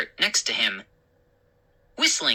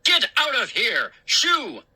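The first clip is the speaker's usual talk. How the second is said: high angry shooing; angry shout; 8 semitones higher than usual. A narrator's reading. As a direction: shoo the dog away furiously. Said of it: "he shouted".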